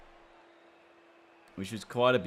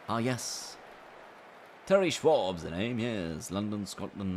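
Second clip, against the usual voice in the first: put-on British accent; 3 semitones higher, slower than usual. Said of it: "British accent".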